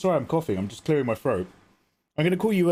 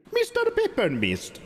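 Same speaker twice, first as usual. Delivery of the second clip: playful sing-song name; 13 semitones higher, slower than usual. Pretend. Repeats viewer name playfully.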